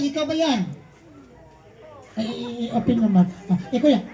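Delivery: ordinary talk, nothing marked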